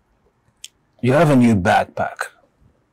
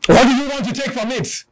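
pitched way up, thin and insistent; urgent repeated question; 14 semitones higher, faster than usual. Acted. Speaker asking the audience what they'd save from the fire, urgent high voice. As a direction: press the question home in a high, straining voice.

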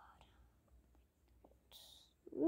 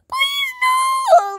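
very high loud cry of no; desperate shriek; 8 dB louder than usual. Acted. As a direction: shriek in despair. Student reacts to detention.

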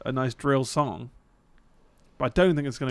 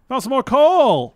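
"Found some more coal!" yelled much higher and louder; excited shout; 12 semitones higher, 15 dB louder, slower than usual. Real, not acted. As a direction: whoop it out like striking treasure.

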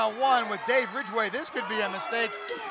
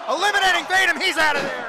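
loud, raised elimination call; excited play-by-play; 5 semitones higher, 7 dB louder, faster than usual. Real, not acted. Commentator calling Venom's elimination, excited shout. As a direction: shout it as it happens.

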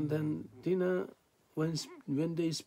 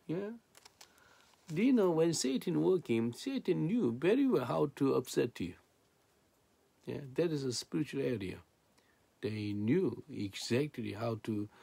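usual speaking voice

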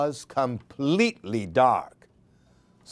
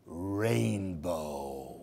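softer, lower, drawn-out rainbow; hushed reveal; 9 semitones lower, 11 dB quieter than usual. Acted. Reader revealing the rainbow with a hush. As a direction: breathe the word out in wonder.

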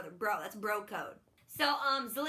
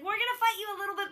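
higher, rushed hedge; mock timid; 8 semitones higher, 3 dB louder, much faster than usual. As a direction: say it fast and high like a nervous mom. Snow voiced, hedging threat.

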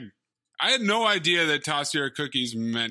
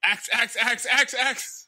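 excited; 7 semitones higher, slower than usual. Real, not acted. Excitedly calling out the axe.

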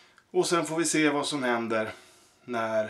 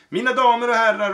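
higher, louder, fast ringmaster shout; calling out; 8 semitones higher, 6 dB louder, faster than usual. Acted. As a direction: announce to the whole tent. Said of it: "ropade han".

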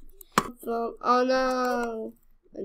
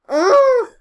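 loud high frustrated ugh; frustrated groan; 14 semitones higher, 12 dB louder than usual. Real, not acted. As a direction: grunt it out in frustration. Frustrated at game.

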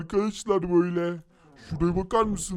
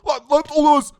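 sudden high, loud startled shout; shocked cry; 8 semitones higher, 8 dB louder, faster than usual. Real, not acted. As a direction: blurt it out in amazement. Finds a secret place, shocked. (şaşkınlıkla yüksek sesle bağırış)

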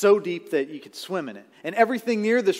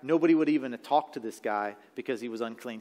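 usual speaking voice

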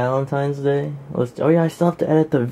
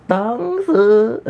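high, loud sing-out of the name; playful call-out; 10 semitones higher, 4 dB louder than usual. Real, not acted. Calling friend's name playfully.

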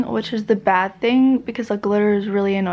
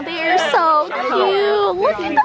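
slow, much higher coo; 7 semitones higher, much slower than usual. Real, not acted. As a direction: coo at them like puppies. Cooing at couple photos.

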